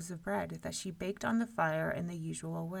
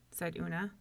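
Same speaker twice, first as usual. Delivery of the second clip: usual speaking voice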